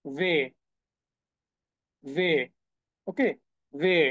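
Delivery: ওয়ে said as veh with a v sound; German letter W. Shown as a demonstration. Teacher drills the German W for students, veh. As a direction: model ওয়ে as veh, short and rising. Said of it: "সেটাকে তারা বলছে ওয়ে".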